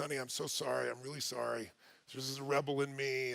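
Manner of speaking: soft, low, sincere; tender apology. Retold or imitated. Husband retelling wife's apology on the plane, tender.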